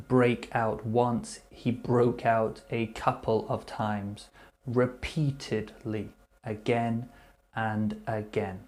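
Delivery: ordinary talk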